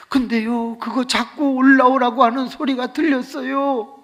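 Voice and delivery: imitated testifying girl. Girl telling students of heaven's ladder, high mock-tearful delivery. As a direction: impersonate a girl giving a wailing testimony. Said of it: "얘가 하는 말이".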